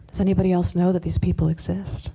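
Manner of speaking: normal talking voice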